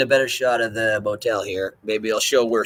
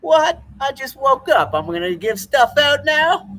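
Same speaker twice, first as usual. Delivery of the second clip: high, loud, slow indignant put-on voice; Lewis Lewis; 13 semitones higher, 6 dB louder, slower than usual. Refusing to give his kidney, high mock outrage. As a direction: squeal it like an outraged cartoon patient. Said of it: "He's like".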